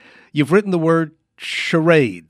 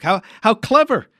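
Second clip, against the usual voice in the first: higher, louder praise; impressed exclamation; 7 semitones higher, 5 dB louder than usual. Real, not acted. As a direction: marvel at the trick. Mime fakes choking.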